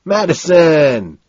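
loud, drawn-out shout of a name; high, slow call; cried back. A narrator's reading. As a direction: holler the name back at him. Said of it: "Price cries back".

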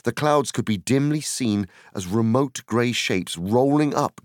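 usual speaking voice

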